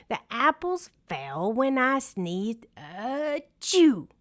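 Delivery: high achoo with a sneeze; sneezing. A narrator's reading. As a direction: end on a sneeze. Bear sneezes.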